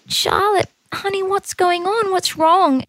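tender concern. Retold or imitated. Mum asks crying daughter.

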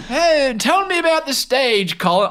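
mock English reporter interview; put-on English accent. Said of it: "in a frickin' different accent".

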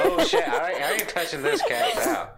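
laughing alarm. Real, not acted. Laughing at the frantic cat.

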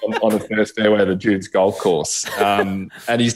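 telling it through real laughter; amused, laugh breaking into the words. Real, not acted. Son recalling his fall on the fairway, laughing.

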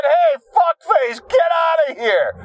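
high shouted mock dismissal; mock boss yell. Boss yelling him out the door, mock shout. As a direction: bark it like a rude boss. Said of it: "it wasn't quite like that".

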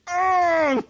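high strained pained oh; high pained groan. Retold or imitated. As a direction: let the pain squeeze out high. Retelling pain of stubbed toe.

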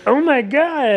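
a high, startled imitation of him; mock shocked gasp. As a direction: gasp it out like you can't believe it. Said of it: "He's like".

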